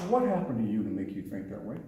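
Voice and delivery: soft, low, concerned question; gentle inquiry. Shown as a demonstration. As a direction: ask calmly instead of attacking. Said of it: "You know what I said?".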